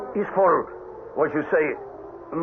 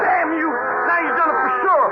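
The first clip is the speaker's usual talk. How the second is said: angry shout; 10 semitones higher than usual. Acted. Angry outburst during stampede.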